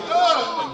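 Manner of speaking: high, loud mock cry; retold yelp of pain; passing-out cry. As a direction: cry out as if about to faint. Retells passing out.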